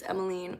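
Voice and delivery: Emmeline ending in "-een"; French pronunciation. Shown as a demonstration. What everyone always thinks it is, Emmeline with "-een". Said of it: "it's the French pronunciation".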